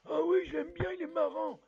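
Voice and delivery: papy says he likes Zartok, high and loud; high squeaky papy. Acted. Papy answers he likes him.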